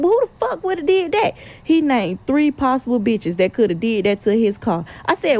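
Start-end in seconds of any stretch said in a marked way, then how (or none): 0.0-1.3 high, fake-innocent question; mock innocent. Feigning innocence to the man whose car she keyed. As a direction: play dumb, voice pitched up.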